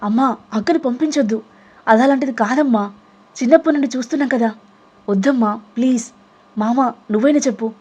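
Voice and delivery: put-on boy begging mother and uncle; younger brother pleading. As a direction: plead for his sister urgently. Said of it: "బ్రతిమాలుతున్నాడు Satvik".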